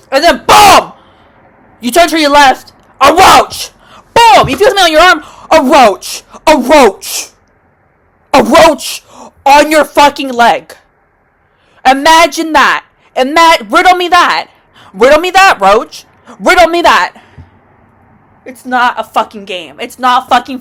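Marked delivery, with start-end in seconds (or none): 0.1-10.8 loud, high, punched exclamations; alarmed outburst. Acted. Acts out sudden roach sighting. 11.9-17.2 loud, higher, punched phrases; emphatic, loud rant; heated challenge. Real, not acted. Ranting challenge to imagine roaches.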